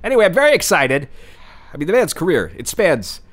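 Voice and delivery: ordinary talk, nothing marked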